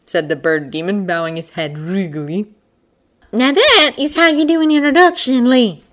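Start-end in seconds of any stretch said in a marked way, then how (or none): 3.3-5.8 high, loud scolding hare; hissing hare. A narrator's reading. Hare tells the man off over introductions, hissing. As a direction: scold him pointedly in a raised voice. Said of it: "hissed Hester towards Lee Scoresby".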